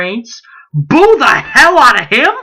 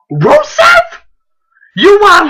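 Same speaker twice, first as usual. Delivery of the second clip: jumps way up in disbelief; high squeaky Rusev, stunned; incredulous; 9 semitones higher, 5 dB louder, slower than usual. Real, not acted. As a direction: say it like you can't believe your ears. Incredulous echo of fans' pick.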